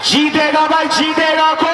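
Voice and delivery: real commentator building suspense; excited hype. Real, not acted. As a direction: throw the question out to the crowd. Hyping who will win.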